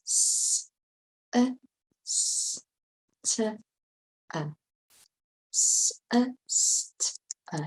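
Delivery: "S, a, s, t, a" as separate sounds, the s a long hiss; sounded out phonetically. Shown as a demonstration. Teacher spelling sister the way you pronounce it, sound by sound. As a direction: sound out sister letter by letter with gaps. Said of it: "the way you pronounce it".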